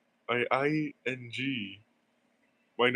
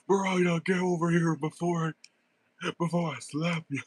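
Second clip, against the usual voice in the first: high, loud playful threat to a character; mock angry yell; 6 semitones higher, 3 dB louder than usual. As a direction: scold Miranda like an angry parent, half joking.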